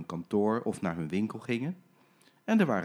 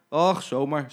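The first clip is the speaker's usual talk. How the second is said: casual brush-off; 4 semitones higher, 7 dB louder, slower than usual. Acted. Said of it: "zei Jim en hij lachte".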